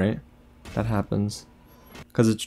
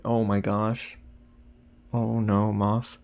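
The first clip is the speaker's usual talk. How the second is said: ordinary delivery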